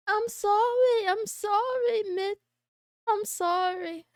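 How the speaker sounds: high, drawn-out playful sorry; mock apology. Streamer apologizing to a chatter, mock-pleading. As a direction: plead forgiveness in a put-on little voice.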